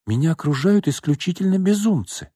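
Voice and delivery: high, weary exasperation; exasperated. Acted. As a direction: sigh it out in despair.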